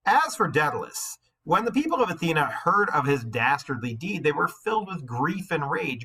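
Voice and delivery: lightly lifted read-aloud voice; steady storybook reading; story narration. A narrator's reading. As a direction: read the myth to the class. Reads the myth aloud.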